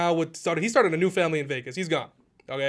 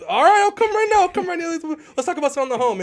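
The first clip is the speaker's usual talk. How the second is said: much higher, louder hurried agreement; mock flustered; 11 semitones higher, 6 dB louder than usual. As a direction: play the flustered yes-man, pitched way up. Agent flustered agreeing to come.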